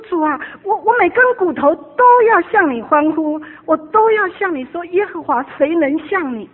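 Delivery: high, loud crying out to God; loud praise. Retold or imitated. Retelling her prayer to the Lord, loud exultant praise. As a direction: shout praise with full heart. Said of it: "好，我说".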